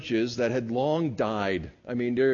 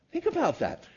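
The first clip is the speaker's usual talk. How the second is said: ordinary delivery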